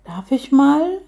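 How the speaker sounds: high, rising, tentative question; hopeful little plea; shy request. A narrator's reading. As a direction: ask bashfully, pitch climbing. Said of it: "fragte sie schüchtern".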